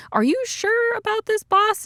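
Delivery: high-pitched questioning underling; doubtful agent. Retold or imitated. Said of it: "the other Whoop agents are asking Jerry".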